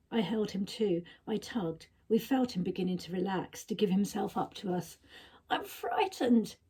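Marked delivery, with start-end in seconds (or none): 5.5-6.6 high, slow frightened squeak; small scared squeak, higher and slowed. A narrator's reading. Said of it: "he squeaked".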